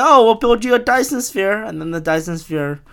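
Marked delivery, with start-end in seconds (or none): 0.0-1.6 high, loud, over-keen offer; mock-eager sales pitch. Con artists offering a Dyson Sphere, in mock-eager high voice.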